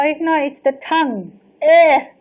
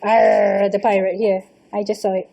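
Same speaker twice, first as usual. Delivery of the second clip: low growly pirate arr; 7 semitones lower than usual. Pretend. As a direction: play a gruff pirate. Host playing pirate.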